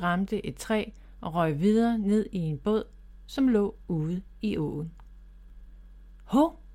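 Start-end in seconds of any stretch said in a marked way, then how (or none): 6.2-6.6 short high surprised "Hov"; a quick, lifted little gasp of surprise; startled hov. Acted. Rabbit Bob as the gift flies off, surprised.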